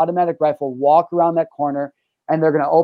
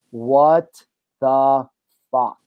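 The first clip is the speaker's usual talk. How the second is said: drawn out word by word; slow, separated words, imitating stunned disbelief; 3 semitones lower, much slower than usual. Retelling stunned reaction to body.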